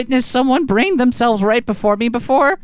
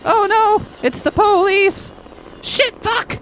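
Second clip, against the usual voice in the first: panicked; 8 semitones higher, slower than usual. Acted. Spotted by the police.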